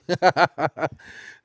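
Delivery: loud, amused laugh. Real, not acted. Singer amused by their buffet comparison, laughing. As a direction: burst out laughing at your own joke.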